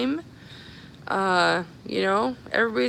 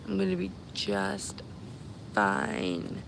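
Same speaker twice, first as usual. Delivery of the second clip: drawn-out reassurance; 7 dB quieter, slower than usual. Real, not acted. Streamer reassuring the followers it will be fine, drawn out. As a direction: stretch out "just", then let "fine" sink.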